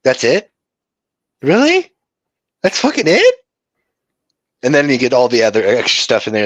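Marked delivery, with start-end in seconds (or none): 0.0-3.3 high, rising disbelief; can't believe it was that easy; incredulous. Retold or imitated. Guitarist recalls learning the riff, high incredulous. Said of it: "you're like".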